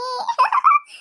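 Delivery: sudden high giggle of glee; gleeful high laugh. Acted. Sister laughs high and gleeful at her scolded brother. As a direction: squeal a gloating laugh.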